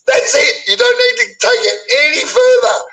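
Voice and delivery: shouting high and hard in real anger; high-pitched, furious shouting at a critic; furious rant. Real, not acted. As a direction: yell it straight at the man who insulted you. Ranting back at Wazzy live.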